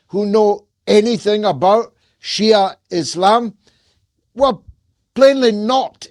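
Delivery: exasperated, incredulous tirade; rising indignation. Real, not acted. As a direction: hammer the rhetorical questions. Rhetorical rant rising in anger.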